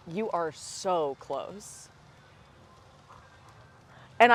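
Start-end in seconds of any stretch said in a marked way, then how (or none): 0.1-1.9 slow, softer, condescending coach; drawn-out patronizing reassurance; imitating the comedy coach. Retelling a comedy coach's advice after a set, slow condescending voice. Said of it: "she goes".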